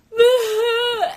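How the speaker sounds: a high, loud burst between laughing and crying; tearful laugh-sob. Real, not acted. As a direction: let the tears break out as a laugh. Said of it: "I don't know why I was so emotional".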